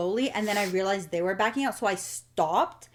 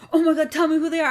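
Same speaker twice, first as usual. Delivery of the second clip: fired-up brother; 8 semitones higher, 5 dB louder, faster than usual. Retold or imitated. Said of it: "he was, like, ready to go".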